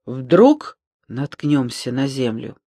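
plain reading voice

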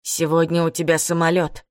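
ordinary delivery

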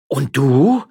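loud, high challenge; accusing retort. Acted. Miller doubting his wife, loud accusing retort. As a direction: throw the blame straight back. Husband throws accusation back.

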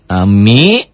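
ordinary delivery

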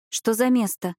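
ordinary delivery

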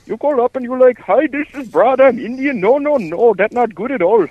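exaggerated sing-song Indian accent, ticking someone off; bad Indian accent. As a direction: lecture in a thick put-on Indian accent. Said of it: "You must have more of the accent".

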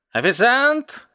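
high call to a waiter; cat hailing the waiter. A narrator's reading. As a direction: call across the bar, high. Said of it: "позвал кот".

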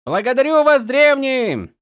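loud, high call of thanks; shouting. Acted. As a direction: call out loudly in gratitude. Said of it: "крикнул он".

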